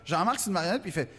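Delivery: ordinary talk, nothing marked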